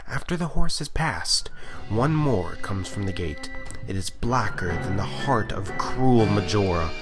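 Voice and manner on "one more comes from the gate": ordinary delivery, nothing marked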